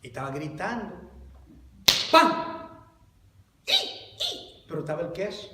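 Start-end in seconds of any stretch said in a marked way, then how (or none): 2.1-2.3 trap snap. Retold or imitated. Rat trap snapping shut. 3.6-4.6 very high squeaky rat squeal; rat squeak. Retold or imitated. Preacher telling the rat story, squealing like the trapped rat.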